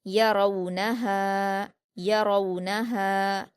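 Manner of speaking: यरौनहा slowly, full रौ glide, long हा; stretched final madd. Shown as a demonstration. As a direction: keep य and न short, hold हा in यरौनहा. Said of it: "दो हरकत खींचकर पढ़ेंगे".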